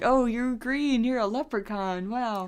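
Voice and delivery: high, drawn-out wonder, put on for fun; mock amazement. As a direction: imitate someone naively amazed. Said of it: "I'm assuming they're like".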